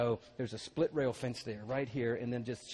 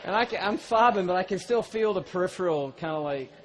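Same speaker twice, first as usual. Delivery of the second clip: loud, driving preacher's storytelling; preaching at full force; 6 semitones higher, 10 dB louder than usual. Acted. Preacher to congregation, loud full-force delivery. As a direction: preach it big to the crowd.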